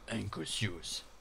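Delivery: low, soft put-on English accent; mock English accent. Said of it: "apprécier mon accent anglais". (faux accent anglais, grave et doux)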